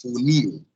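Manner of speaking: "Funil" leaning on -NIL; final syllable of "Funil" strong and falling; stress on last syllable. Shown as a demonstration. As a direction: model "Funil" as foo-NEEW for learners. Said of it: "Sílaba forte é a sílaba final".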